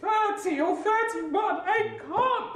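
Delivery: high, slow, tearful protest; frightened and crying, slow and high; scared. Retold or imitated. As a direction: say it near tears, pitch up high. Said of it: "I was scared".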